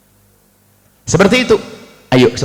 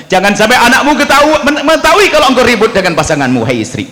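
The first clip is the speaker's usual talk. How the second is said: high, loud sermon push; fiery preaching; 10 semitones higher, 4 dB louder than usual. Acted. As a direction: press the point on husbands and wives. Preaching at full force. (ceramah berapi-api)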